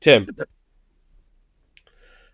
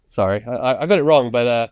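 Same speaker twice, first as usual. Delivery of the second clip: ordinary talk, nothing marked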